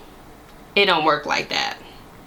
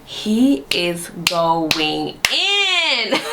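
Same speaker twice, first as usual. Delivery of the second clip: drawn-out, very slow amazed remark; slow-motion wide-eyed reaction; slow disbelief; much slower than usual. Retold or imitated. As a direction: stretch every word out in disbelief. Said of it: "I was just like".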